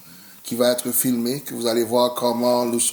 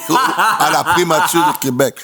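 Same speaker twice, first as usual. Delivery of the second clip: laughing; 4 semitones higher, 7 dB louder than usual. Real, not acted. Laughing while joking about himself.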